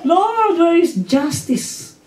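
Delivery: mock a childish believer complaining; whiny complaint. Believer complaining to the Lord about injustice, slow mock whine. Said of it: "Pag nagsasalita tayo ng".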